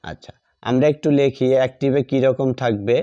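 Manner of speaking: ordinary talk, nothing marked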